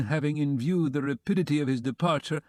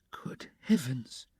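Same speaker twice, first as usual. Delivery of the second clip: slow, breathless whispered gasp of shock; a hushed slow whisper of dismay; stunned whisper; 4 dB quieter, much slower than usual. A narrator's reading. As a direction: barely voice it, struck with horror. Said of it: "cried Pulcheria Alexandrovna".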